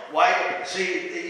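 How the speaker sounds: usual speaking voice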